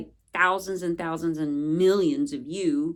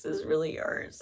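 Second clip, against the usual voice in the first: whispered crying; 3 semitones lower, 5 dB quieter than usual. Retold or imitated. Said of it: "I would just be crying and saying".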